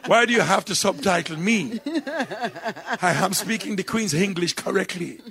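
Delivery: high, prim Queen's English; mock-proper BBC English, high and exaggerated; posh English. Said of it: "people are so speaky spokey".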